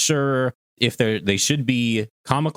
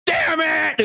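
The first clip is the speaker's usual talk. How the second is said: much higher shouted burst; mock Vegeta shout. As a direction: blurt it like Vegeta snapping.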